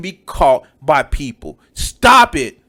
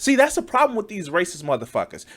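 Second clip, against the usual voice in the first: ordinary delivery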